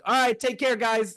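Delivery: high-pitched mock-cheery send-off; acted awkward exit line; hasty goodbye. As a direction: toss out a quick, high farewell. Acting out his quick awkward exit.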